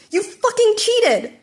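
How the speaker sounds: high, loud, slow accusing yell; furious outburst. Acted. Bakugo rages at Midoriya for winning. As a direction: spit out the accusation in rage.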